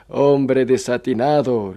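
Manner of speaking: loud, slow, drawn-out rebuke; scoffing judge. Acted. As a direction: exclaim it at a fool, stretching the words. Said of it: "El juez echó a reír".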